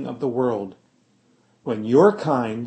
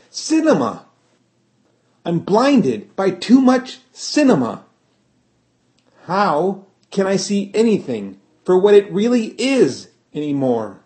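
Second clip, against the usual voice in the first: louder and higher, building into a rant; worked-up outburst; 7 semitones higher, 6 dB louder than usual. Acted. Tim rants to Lafcadio, worked up and loud. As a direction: let the frustration boil over on Cinema. Tim's rising rant about cinema.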